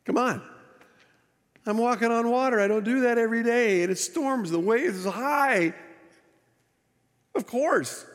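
high-pitched exasperated objection; mock incredulous protest. Peter answering Jesus about doubting on the waves, incredulous. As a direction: protest as if the answer is absurdly obvious.

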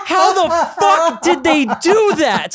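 high, slow disbelief; stunned at what they did; amazed. Retold or imitated. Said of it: "I was like".

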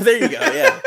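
high laughing reply. Real, not acted. Guest laughing off a compliment, high laughing reply. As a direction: laugh through the words.

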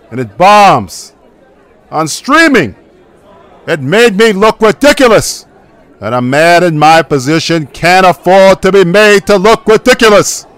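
angry rant. Acted. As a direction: explode with wounded pride. Mobster boss outraged.

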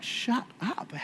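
high, hushed disbelief; incredulous. Retold or imitated. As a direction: squeal it softly, as if you can't believe it. Acted disbelief at pastor's claim.

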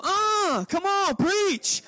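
high, excited mock call of "come on, preach"; mock hype. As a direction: ham up a churchgoer hyping the preacher. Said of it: "I don't read Tolstoy and go".

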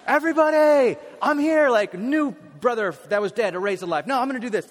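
grand entrance shout. Retold or imitated. Pastor imagining Jesus arriving Oprah-style, shouting triumphantly. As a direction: burst in like a showman. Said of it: "I would have rolled up like, like Oprah".

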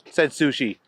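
plain reading voice

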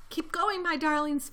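high, mildly strained urging; panted urging. A narrator's reading. Mister Fox to his darlings, panted. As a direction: urge them on as if a little winded. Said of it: "panted Mister Fox".

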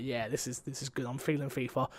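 muttered to self. Retold or imitated. Retelling deciding to play fifa, murmured. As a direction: murmur it to yourself. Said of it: "I'm like".

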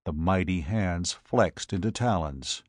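plain reading voice

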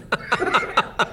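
high breathless laugh; real laughter. Real, not acted. Remembering mom's cheeky advice, high laughter. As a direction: laugh it off, amused.

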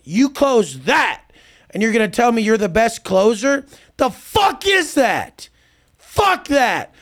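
mocking rant. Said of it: "I'm like".

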